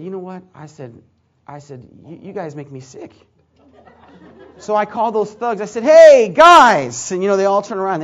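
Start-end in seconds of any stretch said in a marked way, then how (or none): 0.0-3.1 much softer, disgusted under the breath; disgusted rebuke. Retold or imitated. 5.8-7.1 loud call across the room. Retold or imitated. Calling the thugs over.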